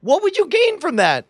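high, rising indignation; indignant plea. Acted. Player argues against being shot, indignant plea. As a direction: demand an answer, aggrieved. Acted betrayal plea to friend.

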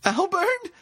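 very high screamed name; anguished high call; desperate scream. A narrator's reading. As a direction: scream after him in panic. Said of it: "she screamed".

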